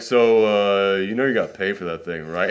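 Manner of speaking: put-on low, offhand teen voice; teenager. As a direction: mumble it like an uninterested teenager. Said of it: "a teenager and was like".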